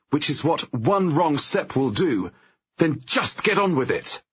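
high, loud, fed-up scolding; angry snapping. Acted. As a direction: let the frustration boil over. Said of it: "I snap".

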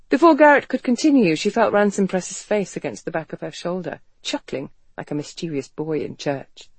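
usual speaking voice

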